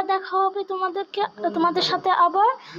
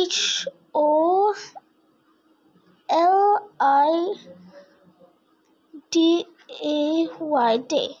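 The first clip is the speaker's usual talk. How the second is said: slow letter-by-letter spelling. Shown as a demonstration. Teacher to class, slowly spelling a word letter by letter. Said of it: "This is how we do syllables".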